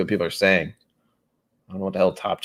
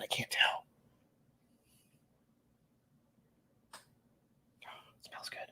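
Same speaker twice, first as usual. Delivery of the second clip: soft unvoiced whisper; 16 dB quieter than usual. Real, not acted. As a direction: whisper it close to the mic. Drops to whisper.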